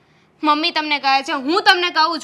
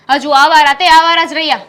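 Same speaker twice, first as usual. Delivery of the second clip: angry scolding; 10 dB louder than usual. Acted. Wife scolding her idle husband.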